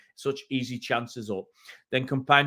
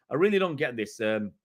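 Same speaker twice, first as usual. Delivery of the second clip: ordinary delivery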